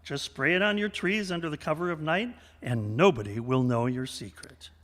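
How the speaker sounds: normal talking voice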